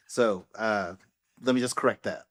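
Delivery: normal talking voice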